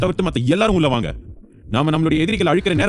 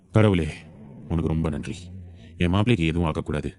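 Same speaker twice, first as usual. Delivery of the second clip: lower, softer thankful father; father softly thanking God, lower; grateful prayer; 9 semitones lower, 4 dB quieter than usual. Acted. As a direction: quietly thank God with relief.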